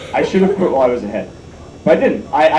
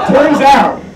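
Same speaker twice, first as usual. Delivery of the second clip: "Turns out" punched higher and louder; emphatic repeat; 10 semitones higher, 7 dB louder than usual. Shown as a demonstration. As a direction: hit "Turns out" hard for the punchline.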